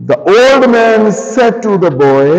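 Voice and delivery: slow, word-by-word dictation to a class; teacher dictating at writing speed. Shown as a demonstration. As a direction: spell out each word so the class can write it down. Teacher dictating a sentence slowly.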